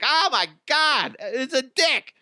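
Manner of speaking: high, slow gleeful gasp; delighted mock gasp. Husband and wife thrilled by the crude joke, high gleeful gasp. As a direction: relish the shock with glee. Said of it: "my wife and I are like".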